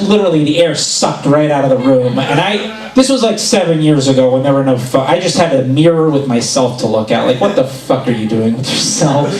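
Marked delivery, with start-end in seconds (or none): none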